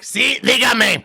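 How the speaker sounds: put-on "Sí, dígame" high, loud and slow in a Spanish accent; Spanish phone greeting. Showing friends how Spaniards answer the phone, high and drawn out in Spanish. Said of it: "in Spanish it's like".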